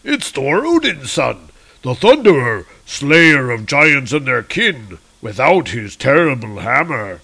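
lightly voiced gloating giant. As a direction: savor finding the hero helpless. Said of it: "And the giants are like".

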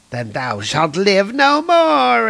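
hammed-up death threat; villain threat. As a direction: draw it out like a gloating villain. Loki threatening Thor.

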